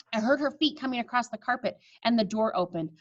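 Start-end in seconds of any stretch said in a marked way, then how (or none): none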